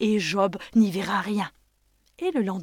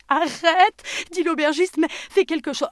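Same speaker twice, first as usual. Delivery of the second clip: pained pleading; 9 semitones higher, 6 dB louder than usual. Acted. Innkeeper begs.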